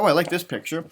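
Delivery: usual speaking voice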